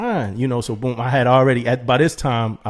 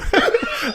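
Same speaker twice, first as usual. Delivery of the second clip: high laughing bursts. Real, not acted. Laughing while telling a friend about getting scammed.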